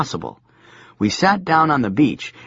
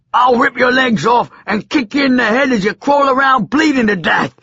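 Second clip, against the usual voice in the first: roaring high madman voice; madman shout; 12 semitones higher, 5 dB louder than usual. Acted. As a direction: scream the threat wildly. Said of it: "said the madman".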